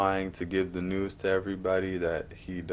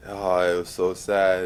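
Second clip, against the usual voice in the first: usual speaking voice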